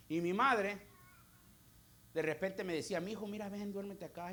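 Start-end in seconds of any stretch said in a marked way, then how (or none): 3.0-4.3 tender mother's call. Retold or imitated. Mother coaxing her son.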